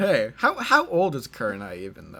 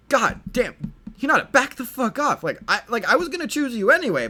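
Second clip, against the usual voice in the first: flustered protest; 8 semitones higher, 4 dB louder than usual. Acted. Naruto pushing Hinata off.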